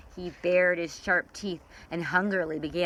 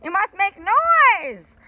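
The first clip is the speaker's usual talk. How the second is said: very high, loud squawked order; shouted; 13 semitones higher, 9 dB louder, slower than usual. Acted. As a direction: rouse the creatures with a shout. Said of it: "he shouted".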